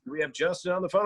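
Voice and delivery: a hint of upbeat on-air announcer; radio DJ. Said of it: "I'll do that radio voice".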